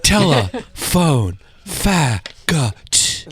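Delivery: "Telephone" very slow, then "fa, ga, t" split into syllables; sounded out syllables. Shown as a demonstration. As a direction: spell the word out like a phone-company ad. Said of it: "You did it phonetically".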